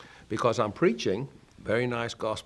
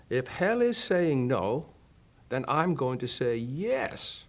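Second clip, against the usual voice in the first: slow, higher, defiant call; shouted back; 5 semitones higher, 3 dB louder, slower than usual. Retold or imitated. Preacher retelling his reply to the voice, slow defiant shout. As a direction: throw it back like a challenge. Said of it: "I instantly shouted back".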